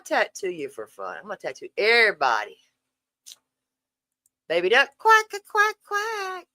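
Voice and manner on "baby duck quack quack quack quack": high, loud quacking duck. Pretend. Streamer greeting a viewer called baby duck with high quacks.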